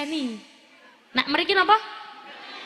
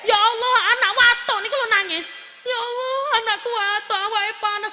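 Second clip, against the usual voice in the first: higher, mock-tearful parent's lament; 8 semitones higher, 3 dB louder than usual. Parent crying over a coughing child, hammed-up tearful lament. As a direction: lament as a worried parent, acted. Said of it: "sampai nangis-nangis nggih".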